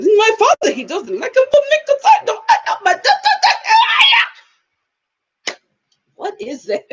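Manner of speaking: very high, squealed mock accent; exaggerated shrieky accent imitation dissolving into babble; mock Borat-like accent. Mimicking a woman discussing her father, high-pitched Borat-like accent. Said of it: "It was giving me a little bit of Borat".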